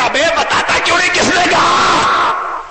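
high yell building to a long bellow; angry demand. Acted. Imam presses silent congregation for culprit, angry shout. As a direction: badger them, then roar the last words.

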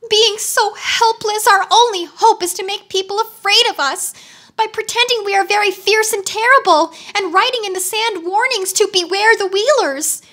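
high, mildly tearful wail; a touch of sobbing, voice high; sobbing wheeler. A narrator's reading. Ruined wheeler laments to Tick-Tock, mild high sob. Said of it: "he sobbed".

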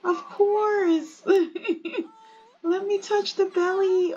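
high voice with giggles through it; giggly high delight. Acted. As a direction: laugh along while reaching for the belly. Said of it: "And she's like".

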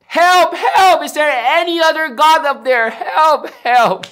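high, loud cries upward; acted yell for help. Man on branch seeks another God, acted desperate shout. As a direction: holler desperately after a big breath. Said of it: "took a deep, long breath".

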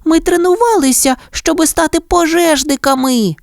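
high-pitched little puppy protest; pleading. A narrator's reading. Puppy Penny explaining to her father, pleading protest. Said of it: "благально мовила Пенні".

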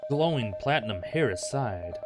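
usual speaking voice

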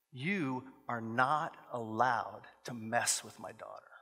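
low, slow, quiet menace; stern warning. Acted. Dad on phone to the mom, controlled stern warning. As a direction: spell it out slowly and softly as a threat.

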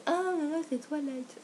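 high, scoffing mock reaction; a scornful "là, là" from people who judge. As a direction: imitate a dismissive crowd. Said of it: "les gens ils sont en mode".

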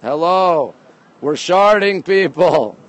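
ordinary talk

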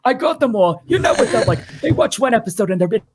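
very high, cartoonish agent on a call; Estelle. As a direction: pitch it way up and announce it to the client, hammed-up. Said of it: "you, it's Estelle".

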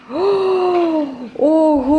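high mock-amazed gasp; surprised. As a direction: ooh and wow for the toddler. Child's gift surprise.

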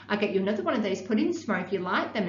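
ordinary delivery

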